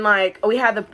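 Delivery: ordinary delivery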